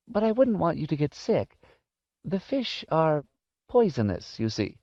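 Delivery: put-on high, gently explaining old lady; high old-lady voice, easing off at the end; old woman's voice. A narrator's reading. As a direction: pitch it up as a cautioning elderly woman. Voices Missus Griffin explaining.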